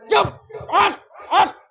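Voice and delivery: sharp, high bursts cutting someone off; barked rebuke. Acted. Elders silencing the accused boy, barked rebuke. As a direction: snap the orders out one by one. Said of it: "اسے ہڑکایا".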